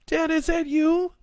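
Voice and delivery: high, loud daughter voice on the phone; scared daughter. A narrator's reading. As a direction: reach out to her father in fear.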